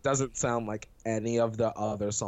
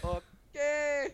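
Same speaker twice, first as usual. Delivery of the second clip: high fake sob; mock crying; much slower than usual. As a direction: whimper it in fake tears. Mock crying over the song.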